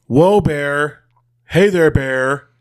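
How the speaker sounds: mock call to ward off an animal. Podcaster imitating a skier facing a bear, calling out.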